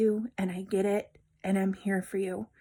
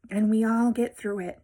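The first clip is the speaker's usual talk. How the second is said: usual speaking voice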